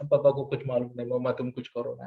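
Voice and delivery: ordinary talk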